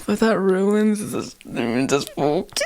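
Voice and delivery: slow, high protest dissolving into laughter; laughing protest. Real, not acted. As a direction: object while cracking up.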